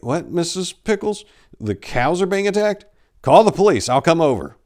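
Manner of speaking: rattled, hurrying to help; alarmed priest. A narrator's reading. Priest on the phone hearing of dead cows, alarmed.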